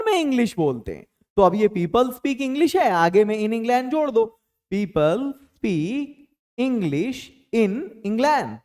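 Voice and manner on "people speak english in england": teacher dictating a sentence, very slowly; very slow drill. Shown as a demonstration. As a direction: stretch each word out slowly. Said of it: "ये command हमको इस तरह से करना होता है".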